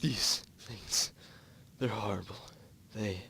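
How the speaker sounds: distraught. Acted. Distraught unicorn.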